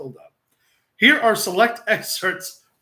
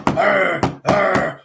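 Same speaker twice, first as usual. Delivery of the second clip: loud whining mock dog groans; hammed-up louder dog whine; 7 dB louder than usual. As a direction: groan twice like a miserable bulldog. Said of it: "I hear the dog go".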